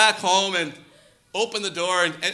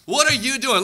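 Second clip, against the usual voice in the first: rebuking question; 6 semitones higher, 5 dB louder, slower than usual. Acted. Preacher's rebuke to audience.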